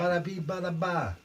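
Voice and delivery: hammed-up New York accent. Shown as a demonstration. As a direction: toss it off like a cartoon New Yorker. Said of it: "you go to New York".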